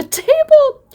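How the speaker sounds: very high, slow laughing words. Real, not acted. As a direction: laugh through it. Laughing at the panicked woman.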